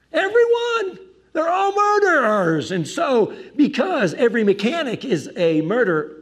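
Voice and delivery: loud, high mock outrage; over-the-top yelling, easing slightly as it goes; hammed-up shouted accusation. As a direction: shout it like a wild sweeping charge. Mock accusation shouted in retold argument.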